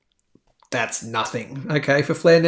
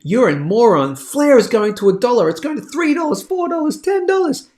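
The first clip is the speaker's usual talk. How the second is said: louder, climbing higher with each dollar figure; mocking Flare fans; 7 semitones higher, 4 dB louder than usual. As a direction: ham up an overexcited crypto believer shouting prices. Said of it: "pop on this and go".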